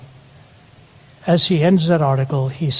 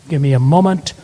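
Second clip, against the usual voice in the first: ordinary talk, nothing marked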